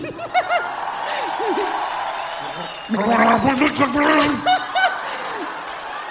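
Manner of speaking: laughing. Real, not acted. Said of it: "je me repousse".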